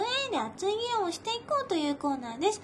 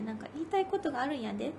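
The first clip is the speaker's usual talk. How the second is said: quick, soft Kansai-dialect version of the chant; 2 semitones lower, 5 dB quieter, faster than usual. Retold or imitated. Cohost trying the chant in Kansai dialect, quick and soft. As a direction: toss off the fan chant in light Kansai dialect. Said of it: "関西弁バージョンいいね".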